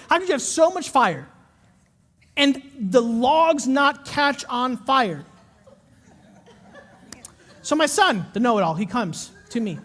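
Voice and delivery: ordinary talk, nothing marked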